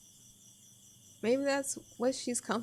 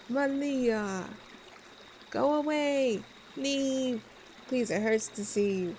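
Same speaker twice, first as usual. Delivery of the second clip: raised, strained plea to go away; pleading through hurt; 3 semitones higher, slower than usual. Acted. Daughter begging her parents to leave, strained and pleading. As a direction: push them away, voice cracking high.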